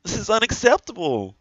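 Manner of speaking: very high, drawn-out squeal of disbelief; high-pitched, stretched-out incredulous whine; outraged squeal. Real, not acted.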